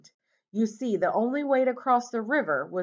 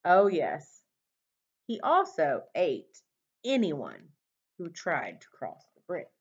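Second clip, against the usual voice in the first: ordinary delivery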